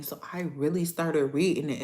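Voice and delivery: normal talking voice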